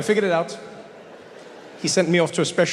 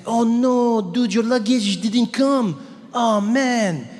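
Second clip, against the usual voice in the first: sympathetic clerk; 7 semitones higher, slower than usual. Retold or imitated. Paris clerk to the comedian about lost luggage, high overdone sympathy.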